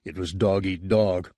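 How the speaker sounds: ordinary delivery, nothing marked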